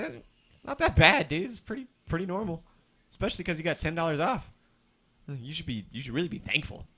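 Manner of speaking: mock scolding retort. Worker telling off the customer in his head, mock scolding. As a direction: lecture the cheapskate.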